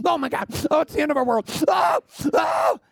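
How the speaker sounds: freaking out. Acted. Terrified brothers after Simeon's arrest, hammed-up wailing. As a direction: wail like it's the end of the world. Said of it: "freaking out".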